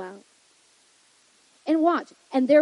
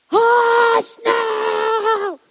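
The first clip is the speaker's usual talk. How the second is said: very high, drawn-out holler; hammed-up crazy scream, stretching each word; crazed mock yell; 13 semitones higher, 11 dB louder than usual. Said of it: "waking up and being, like, crazy?".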